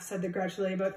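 ordinary delivery

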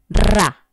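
ra said as one clear beat; syllable demo. Shown as a demonstration. Teacher showing two sounds merged, one syllable beat. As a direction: join r and a into one punch, ra. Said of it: "cuando tú los dices en un solo golpe de voz".

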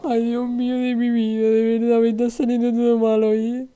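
high, sustained mock-tearful moan; whiny lament. Streamer lamenting everything going wrong today, high whine. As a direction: moan it on one high note.

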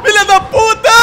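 shouted panicked curse; panicking gamer. Real, not acted. Chased in game, swearing.

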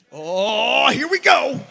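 a loud, laughing "oh, here we go"; mock dread, laughing. Wife's challenge lands on him.

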